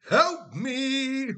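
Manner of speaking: high, loud comic cry for help; mock plea for help. Streamer to chat after mum's beef lettuce, loud mock cry. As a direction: throw up a hammed-up call for rescue.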